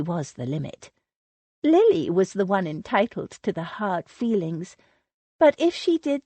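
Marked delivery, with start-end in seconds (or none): none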